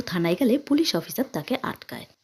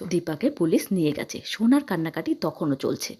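usual speaking voice

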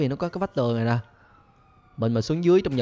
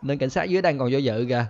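normal talking voice

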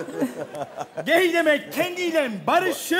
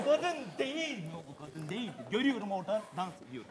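much softer, lower fade-out; fading away; 8 semitones lower, 12 dB quieter, much faster than usual. Real, not acted. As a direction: let the voice die away.

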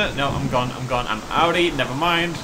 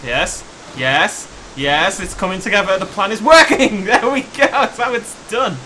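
loud gleeful cheer; triumphant; 5 semitones higher, 9 dB louder, slower than usual. Real, not acted. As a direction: shout it like the plan just worked. Plan finally works in game.

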